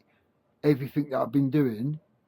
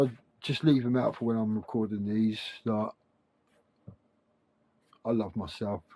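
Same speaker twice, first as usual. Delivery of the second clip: ordinary delivery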